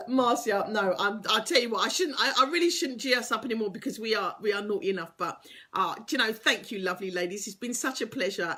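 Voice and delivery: ordinary talk, nothing marked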